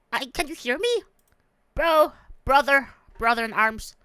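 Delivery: normal talking voice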